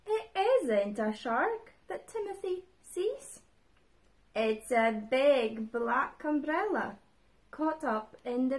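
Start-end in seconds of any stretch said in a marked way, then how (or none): none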